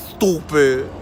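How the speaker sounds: a lightly put-on big-guy voice for stupid; six nine voice. Shown as a demonstration. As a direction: say stupid slowly as a mock big man, voice only a touch changed. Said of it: "In my six nine voice".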